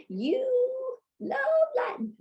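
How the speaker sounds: very high, slow, loud playful gush; gushing enthusiasm. Shown as a demonstration. Teacher tells students what they love, gushing playfully. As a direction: sing out the words with exaggerated delight. Teacher gushes an example sentence.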